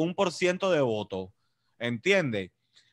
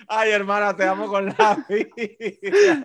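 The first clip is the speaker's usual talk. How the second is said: laughing affection; 5 semitones higher, 4 dB louder, slower than usual. Real, not acted.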